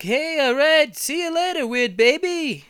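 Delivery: high, loud cartoon goodbye; goofy driver voice. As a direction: wave him off like a dopey sidekick. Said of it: "He's like".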